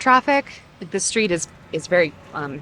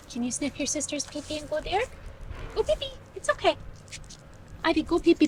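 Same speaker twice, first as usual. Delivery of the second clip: high, soft sing-song coaxing; coaxing pet talk; 7 semitones higher, 9 dB quieter, faster than usual. Real, not acted. Owner coaxing her dog to pee outside, high soft baby talk.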